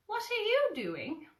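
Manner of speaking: little high piglet hello. Acted. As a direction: call out in a tiny high piglet voice. Piglet voiced.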